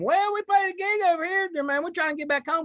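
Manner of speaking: put-on high, loud country-boy patter; hammed-up good old boy. Driver asking the officer for directions, hammed-up innocence. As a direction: play the harmless, lost country boy.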